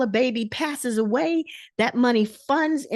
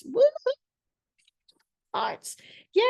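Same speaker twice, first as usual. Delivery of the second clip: excited cheer; 14 semitones higher than usual. Real, not acted. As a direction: cheer the audience on. Cheering attendees' reactions.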